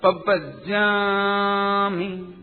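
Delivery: पब्बजामी drawn out slowly with a doubled ब; careful syllable-by-syllable पब्बजामी; slow pronunciation. Shown as a demonstration. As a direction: stretch पब्बजामी, pab-ba-ja-mi, leaning on the ब्ब. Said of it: "पब्बजामी उच्चारण".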